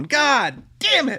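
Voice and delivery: high, drawn-out frustrated curse; exasperated groan. Retold or imitated. Viewer seeing the rescuer shot, exasperated. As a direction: stretch it out in dismay.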